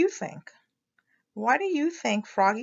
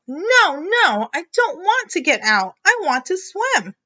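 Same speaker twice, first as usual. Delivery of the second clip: higher, louder joyful refusal; excited protest; 9 semitones higher, 5 dB louder than usual. Acted. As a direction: refuse happily, full of fun. Frog protesting gleefully.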